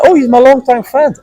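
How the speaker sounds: high, light mocking girlfriend voice; a hint of her innocent excuse; mocking girlfriend's excuse. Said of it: "and then she say".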